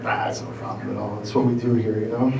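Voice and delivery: soft, low brush-off; modest, soft reply. Retold or imitated. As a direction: play down the thanks quietly. Modest reply acted to shopper.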